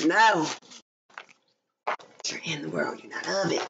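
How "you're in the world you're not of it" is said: soft secret whisper; breathy whispered message. Acted. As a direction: hush it like a secret.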